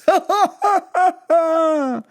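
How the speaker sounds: high, slowing giggle; high laugh. Pretend. Host laughing after nap remark, high laugh. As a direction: laugh heartily after your own line.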